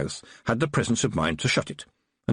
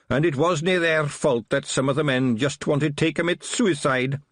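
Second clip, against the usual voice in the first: higher-pitched Scottish little fighting man; Wee Mad Arthur; 7 semitones higher than usual. A narrator's reading. Small Feagle policeman to Tiffany, higher Scottish brogue.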